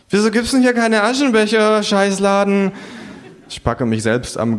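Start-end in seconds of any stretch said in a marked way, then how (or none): 0.1-2.7 high, griping kangaroo voice; cursing kangaroo. A narrator's reading. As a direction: grumble it high like a fed-up kangaroo.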